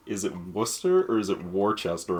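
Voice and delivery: first "Worcester" as WOOS-ter, second as WAR-chest-er; two rival pronunciations. Retold or imitated. Friend asking which is right, "Worcester" said short, then spelled out. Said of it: "my friend was like".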